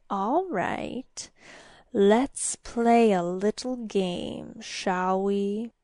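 slow, soft, wheedling creature voice; sly demon. A narrator's reading. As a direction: propose it slowly with sly relish. Demon slyly proposes a game.

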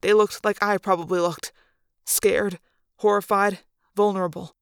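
usual speaking voice

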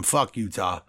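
ordinary talk

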